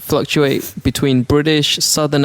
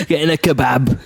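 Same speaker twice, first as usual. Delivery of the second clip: put-on British accent. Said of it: "old accent like that".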